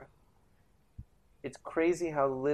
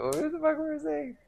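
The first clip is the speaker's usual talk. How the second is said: bewildered exclamation. Real, not acted. Losing track, exclaims.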